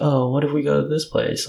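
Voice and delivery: feigned casualness. Retold or imitated. Man retelling his offhand sushi invitation to his date, feigned casualness. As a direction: toss it out as if unplanned. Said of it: "if I casually".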